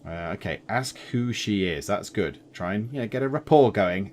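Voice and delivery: normal talking voice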